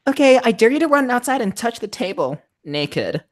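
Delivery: high put-on character voice; friend setting a dare. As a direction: pitch it up and deliver the challenge.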